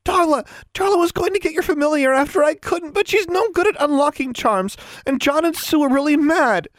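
put-on very high, panicky girl blurting it out; cringing blurt. A narrator's reading. Tomo confesses about Darla to Alexandra, blurting fearfully. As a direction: blurt it all out, high and frightened. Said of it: "Tomo cringed and blurted out".

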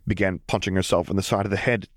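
ordinary delivery, nothing marked

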